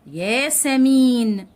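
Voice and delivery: ياسمين slow and loud, "yaa-sa-meeen"; ياسمين with a long, held final syllable; drawn-out long vowel. Shown as a demonstration. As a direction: hold the "ee" after the m in ياسمين. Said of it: "مدت حرف الميم عشان يبقى حرف ممدود".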